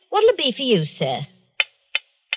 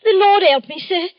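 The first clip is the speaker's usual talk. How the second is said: frightened gasp; 7 semitones higher, 5 dB louder than usual. Acted. Shopkeeper frightened by murder news.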